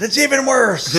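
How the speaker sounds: high, loud mock outburst; exasperated director. Director to struggling singer, hammed-up exasperated outburst. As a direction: imitate a director crying out in dismay.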